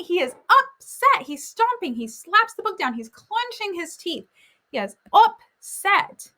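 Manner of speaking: high, slow, every point punched; teacher driving home Greg's anger to the class; emphatic explanation. Shown as a demonstration. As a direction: drive home that he is upset. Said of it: "teacher stresses Greg's anger".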